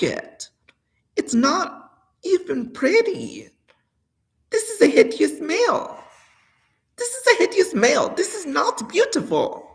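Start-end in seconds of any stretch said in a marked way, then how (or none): 1.2-9.7 much higher, louder cry of disgust; wailing in disgust at the male body; high disgusted outburst. Real, not acted. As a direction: shriek the disgust at your reflection.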